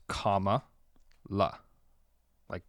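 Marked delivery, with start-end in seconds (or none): 0.1-1.6 comma, then la, in separate pieces; spelled-out pronunciation. Shown as a demonstration. Host reading the guide aloud, comma and la split apart. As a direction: break it up as COMMA, pause, la. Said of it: "it says quotations".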